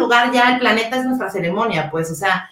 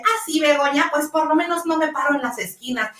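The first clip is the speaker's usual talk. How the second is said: higher, snide schoolkid comeback; mock playground taunt, pitched higher; retold childish insult; 6 semitones higher than usual. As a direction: sneer it like a hurt twelve-year-old. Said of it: "le dije".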